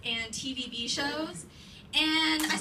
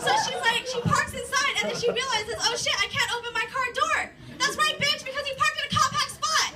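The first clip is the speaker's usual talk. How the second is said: mocking gloat; 9 semitones higher than usual. Mocking her realization, then gloating.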